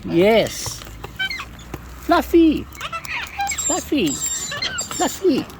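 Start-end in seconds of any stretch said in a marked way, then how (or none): 2.1-5.4 high pet-name call; sweet high calling. Real, not acted.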